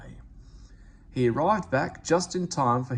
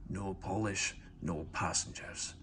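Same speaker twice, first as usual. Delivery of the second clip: much softer, low, stubborn muttering; obstinate mutter; 4 semitones lower, 9 dB quieter than usual. A narrator's reading. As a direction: mutter to himself, refusing to budge. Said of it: "in an obstinate sort of voice".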